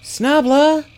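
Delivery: loud, high-pitched call. A narrator's reading. Zelly calls after the kobold she lost sight of, calling out. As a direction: shout the name after someone out of sight. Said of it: "Zelly called".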